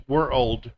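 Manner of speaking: put-on "we're old" split into two words. Shown as a demonstration. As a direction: break it into "we're" then "old". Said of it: "like you're saying two words".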